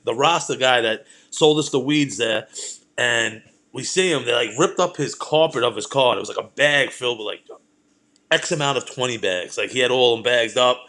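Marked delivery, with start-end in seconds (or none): none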